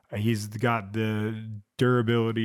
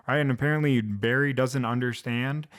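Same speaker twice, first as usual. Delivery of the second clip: ordinary talk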